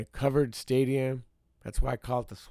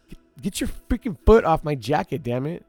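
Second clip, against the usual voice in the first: loud, higher furious yell; angry yell; 4 semitones higher, 8 dB louder than usual. Retold or imitated. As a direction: bark the order at her. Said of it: "And I got mad".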